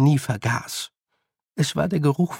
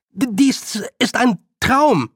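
high, slow stammered shout; calling, growing louder; 10 semitones higher, 4 dB louder, slower than usual. Acted. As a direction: call it out haltingly, building in volume. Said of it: "seine Stimme wurde lauter". (stotternd rufen, lauter werdend)

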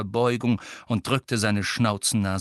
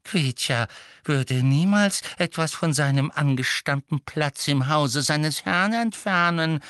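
protesting house-elf; 7 semitones higher, 3 dB louder than usual. Acted. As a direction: protest with stretched, pompous deference. Elf protests innocence to master. (unterwürfig protestierender Hauself)

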